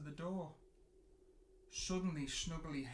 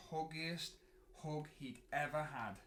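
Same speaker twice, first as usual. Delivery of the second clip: ordinary delivery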